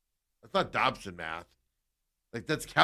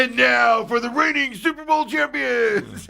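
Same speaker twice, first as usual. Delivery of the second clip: slow, loud, higher announcer boom; 12 semitones higher, 11 dB louder, slower than usual. Retold or imitated. Imitating the Make-A-Wish kid announcing Seattle, slow booming call. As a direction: draw it out like a stadium hype man. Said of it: "And, every".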